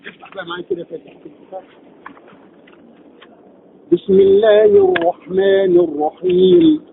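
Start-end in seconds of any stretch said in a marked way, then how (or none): 3.9-6.8 slow Quran recitation. Shown as a demonstration. Teacher recites the Basmala as a tajweed model. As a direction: chant it slowly like a reciter. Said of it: "هذا بقى هو التطبيق العملي".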